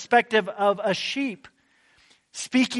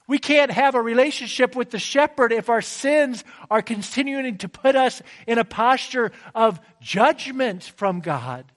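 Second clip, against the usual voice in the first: fervent preaching; 3 semitones higher than usual. Acted. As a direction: plead with the congregation to believe. Preacher's fervent gospel appeal.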